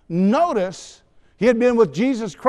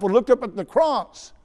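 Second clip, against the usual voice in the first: ordinary talk, nothing marked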